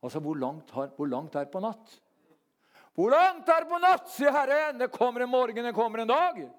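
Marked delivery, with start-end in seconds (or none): 2.9-6.5 thundering proclamation. Acted. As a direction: cry it out to the whole congregation. Preacher proclaims God's answer.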